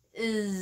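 long, loud buzzing "izzz" for "is"; throat-buzzing Z. Shown as a demonstration. Teacher showing the throat vibration, long buzzed "is". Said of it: "thì cổ phải rung".

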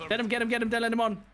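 high, urgent call to teammate; urgent excited shouting. Real, not acted. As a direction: push them to act now. Urging teammate mid-fight.

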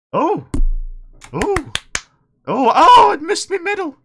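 high, loud cry of dismay; dismayed shout. Real, not acted. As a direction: yell out as the medal slips past. Missed the medal screen.

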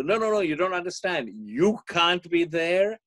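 mock pleading, dragging the words; pleading son. Son begging his mother, hammed-up insistence. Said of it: "So he said".

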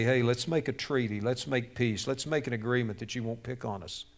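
low, very soft appeasing voice; submissive, hushed offer of peace; meek pleading. Acted. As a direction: plead quietly, as if bowing to a bully. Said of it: "begin to bow and say".

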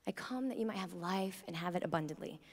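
gentle hushed recitation of a loved verse; hushed reverent quote. Shown as a demonstration. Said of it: "I love this quote".

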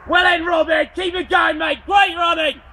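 loud, high shouts of encouragement; urging runners on from the course; cheering shout. Real, not acted. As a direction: bellow support at passing runners.

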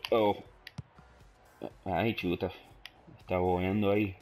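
ordinary talk, nothing marked

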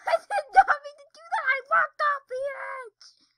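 loud, very high wail; dismayed scream. Real, not acted. Player who walked off the edge, dismayed scream. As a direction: shriek in real dismay.

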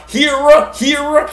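"Hira" said HEER-uh, with an EER vowel; variant pronunciation. Shown as a demonstration. Host trying names for the garden knife, "Hira" as high HEER-uh. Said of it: "whatever you wanna call it".

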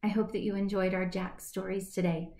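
usual speaking voice

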